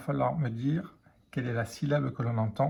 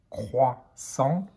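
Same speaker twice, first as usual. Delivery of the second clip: "croissant" slowly, as crois-sant; split into syllables. Shown as a demonstration. Teacher showing pupils the syllable heard, word split in two. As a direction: pause between the syllables of "croissant". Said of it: "on entend la syllabe".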